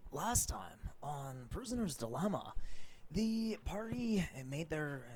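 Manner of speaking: recap narration. Acted. Game master's recap of last session.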